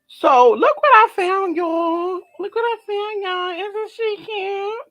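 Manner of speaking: high, loud squeal over a cute find. Real, not acted. Vlogger squeals to viewers over a cute candle, high and gushing. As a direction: gush like you just found treasure.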